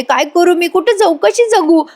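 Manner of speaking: wailing sister-in-law in a lightly imitated weepy voice; mock crying. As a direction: overdo the tears like a serial heroine. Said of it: "पायल रडून म्हणते".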